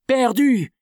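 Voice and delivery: the crew throws the word back; panicked echo. Acted. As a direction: crew echoing the captain in alarm.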